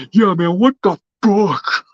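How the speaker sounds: put-on slow, slurred punch-drunk Stallone voice; mock a concussed Stallone drawl; high, slow, slurred Stallone parody; Johnny Zerka impression. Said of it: "he talks like this".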